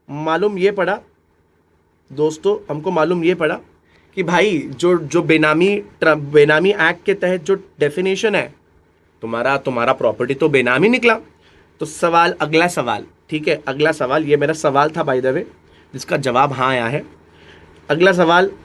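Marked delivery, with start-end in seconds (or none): none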